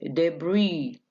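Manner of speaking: ordinary talk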